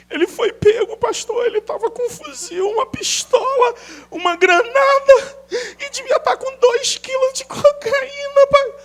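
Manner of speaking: high, wailing mother's lament; mock weeping mother. Pastor mimicking a mother defending her son. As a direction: whine it like a doting mother. Mother voiced, crying over arrest.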